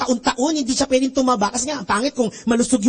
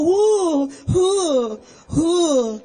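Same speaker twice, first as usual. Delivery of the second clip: mock sobbing; 4 semitones higher, much slower than usual. Mocking crying churchgoer.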